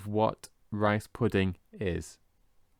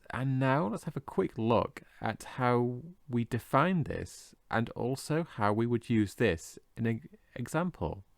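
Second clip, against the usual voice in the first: ordinary delivery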